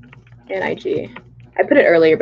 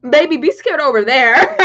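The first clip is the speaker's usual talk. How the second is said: higher and louder through laughter; laughing; 6 semitones higher, 6 dB louder than usual. Real, not acted. Streamer laughing at scared foreign fans. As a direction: tease them while cracking up.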